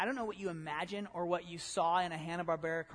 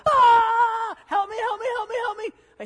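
high, loud, desperate cries for help; panicked screaming; 16 dB louder than usual. Acted. Terrified king screaming for his enchanters in panic. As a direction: shriek it like a king losing all control. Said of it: "kept on screaming".

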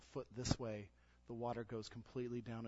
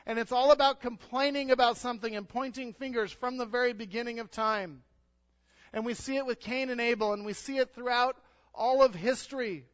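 loud, high preaching; preaching at full force; 12 semitones higher, 13 dB louder than usual. Acted. Pastor to church, preaching at full force. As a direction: drive the point home.

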